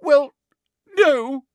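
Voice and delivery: high, loud fake sob; sobbing. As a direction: cry the words out, put on. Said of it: "sobbed Hermione".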